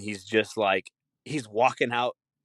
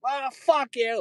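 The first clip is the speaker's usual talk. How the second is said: high huffy curse; mock cranky old-timer; slower than usual. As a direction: snap at them like a grumpy geezer. Old people cursing.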